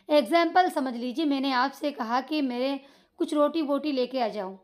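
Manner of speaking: normal talking voice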